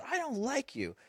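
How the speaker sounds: put-on high, soft, sulky kid voice; mock crying kid. Imitating his crying son sulking at him. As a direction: whimper it like a crying five-year-old. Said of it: "He goes".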